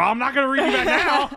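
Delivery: high, loud hammed-up outburst; imitating a furious protest at high pitch; mock angry. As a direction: blow up in comic exasperation, half laughing. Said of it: "He's a little mad".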